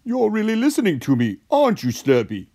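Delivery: high, proud cartoon voice falling at the end; proud Socko. A narrator's reading. Socko praises Slurpee for listening, proud high voice. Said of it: "said a proud Socko".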